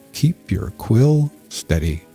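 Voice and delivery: usual speaking voice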